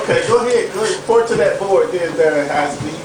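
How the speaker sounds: high sarcastic urging. Acted. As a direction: needle him mockingly. Sergeant mocks the soldier's report.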